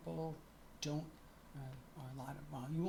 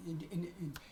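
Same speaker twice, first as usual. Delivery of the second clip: usual speaking voice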